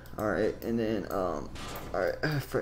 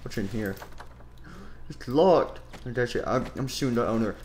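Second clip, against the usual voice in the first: ordinary delivery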